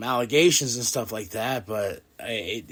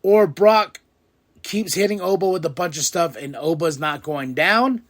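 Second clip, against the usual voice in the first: excited; 5 semitones higher, 5 dB louder than usual. Retold or imitated. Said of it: "I was like".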